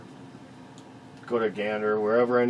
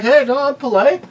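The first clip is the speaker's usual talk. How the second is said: high, sarcastic sweetness, much higher and louder; mock polite; 13 semitones higher, 10 dB louder than usual. Taunting scared shoppers with fake politeness, high and mocking. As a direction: sneer politely while holding the door. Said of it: "It's like".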